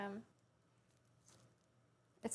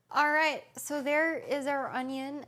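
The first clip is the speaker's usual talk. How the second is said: loud restart; 3 semitones higher, 7 dB louder than usual. Real, not acted. Restarting cooking, addressing viewers.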